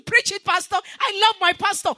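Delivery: hammed-up cheer for the pastor; mock church shout. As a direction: shout it like the fans in the pews. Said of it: "you are shouting".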